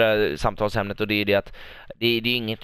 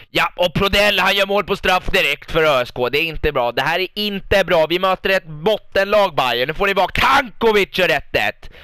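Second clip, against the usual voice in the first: higher, louder live match calling; tense play-by-play; 6 semitones higher, 4 dB louder than usual. Real, not acted. Manager narrating the Örebro match live, tense louder play-by-play. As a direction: call the game with rising tension.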